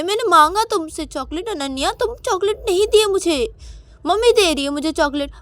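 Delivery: put-on very high, loud childish whining outburst; hurt angry tantrum. Payal accuses Ananya of scolding her before everyone, high angry tantrum. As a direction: wail out every grievance at full pitch.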